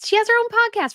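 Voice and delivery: high, quick exasperated outburst. Real, not acted. Host protesting at the podcast clip, exasperated. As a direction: protest in disbelief, pitch shooting up.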